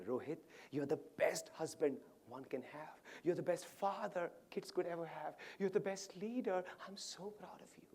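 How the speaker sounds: soft praise climbing in pitch; quiet, rising, tearfully acted devotion; imitated tearful tribute. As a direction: build tenderly, then sink to a whisper. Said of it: "I am crying, Ashish".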